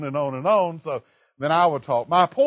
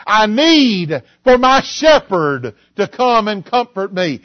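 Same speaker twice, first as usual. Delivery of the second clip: loud, higher, slow declaiming of scripture; full-force preaching; 5 semitones higher, 7 dB louder than usual. Acted. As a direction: thunder the verse to the church.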